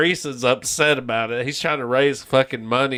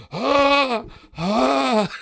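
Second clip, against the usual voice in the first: mock gasps; 11 semitones higher than usual. Teacher's heart attack gasps.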